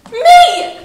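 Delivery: high, loud burst of delight; thrilled exclamation. Acted. New teacher marvelling at being the real teacher, thrilled. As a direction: burst out with excited pride.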